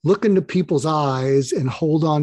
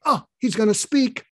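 higher, mock-eager murmur of the crowd; eager anticipation; 7 semitones higher than usual. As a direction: lift it like a room leaning in. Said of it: "the whole room leans towards me".

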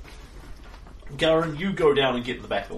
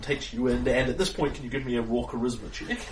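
ordinary talk

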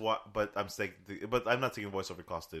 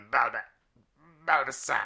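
creature call of the name; Bulbasaur cry; 4 dB louder than usual. Pretend. Podcaster shows off his Bulbasaur cry to his cohost. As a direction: squawk it like the Pokemon. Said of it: "I do a pretty good Bulbasaur".

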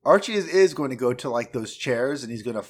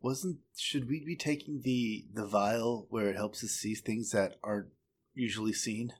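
low muttered aside; mumbling; 3 semitones lower, 10 dB quieter than usual. Acted. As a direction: mutter it under the breath. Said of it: "I mumble".

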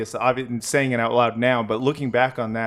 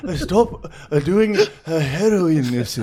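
put-on hammy Japanese accent, laughing through it; joking fake Japanese accent; 7 semitones higher, 3 dB louder than usual. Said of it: "with my Japanese accent".

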